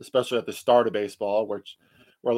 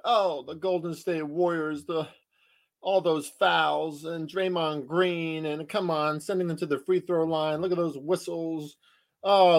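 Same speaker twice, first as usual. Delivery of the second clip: mock complaining; 4 semitones higher than usual. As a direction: mimic a fan moaning about the refs. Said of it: "I don't wanna hear this B S".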